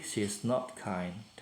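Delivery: slow, carefully spaced negative sentence; slow model sentence. Shown as a demonstration. Teacher models the negative for pupils, slow and clear. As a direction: say it slowly so learners hear each word. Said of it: "Kalau dia tidak baik".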